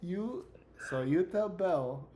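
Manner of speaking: slow, high, put-on Italian accent; French-Italian accent. Character addressing the players about Bell, French-Italian accent. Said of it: "fifty-year-old Italian man".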